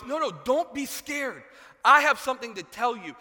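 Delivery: high, loud angel calling out not to panic; big, hammed-up reassurance from on high; reassuring angel. Preacher as the angel calming Zechariah, loud and high. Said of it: "And he goes".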